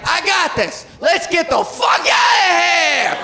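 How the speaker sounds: high, drawn-out panicked yell; panicked legs. Acted. Catcaller's legs urging escape, hammed-up panicked shout. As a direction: yell it like you're fleeing for your life. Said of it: "his legs were like".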